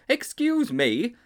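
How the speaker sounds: high, slow polite protest; protesting boy voice. A narrator's reading. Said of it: "he protested".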